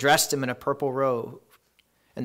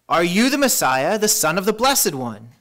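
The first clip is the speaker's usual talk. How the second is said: pointed question, higher and louder; high priest's forceful questioning; demanding high priest; 5 semitones higher, 6 dB louder than usual. A narrator's reading. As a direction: press the accused with a raised voice. High priest questions Jesus in reading.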